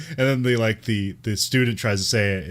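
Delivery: ordinary talk, nothing marked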